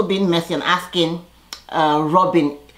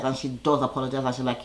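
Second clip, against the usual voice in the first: ordinary talk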